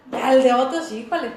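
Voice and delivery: a sudden loud híjole; loud, incredulous outburst; exasperated exclamation. Real, not acted. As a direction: burst out at how hard it is. Exclaiming over confessing others' sins.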